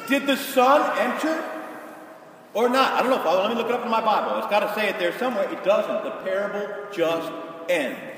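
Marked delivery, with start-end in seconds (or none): 0.1-5.5 higher and louder, fast, hammed-up hurry; mock excited. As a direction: frantically flip the Bible. Preacher acts out frantic Bible search.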